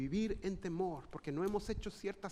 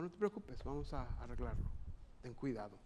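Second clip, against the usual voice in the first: calm reassurance; 7 semitones lower, 8 dB quieter than usual. Retold or imitated. Father unexpectedly calm after crash.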